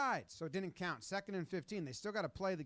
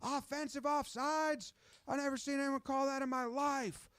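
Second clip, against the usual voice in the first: higher, furious at the referee; voiced quarterback shouting at the official; mock angry yell; 9 semitones higher than usual. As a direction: chew out the ref. Voicing Mahomes yelling at ref.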